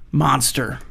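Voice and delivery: normal talking voice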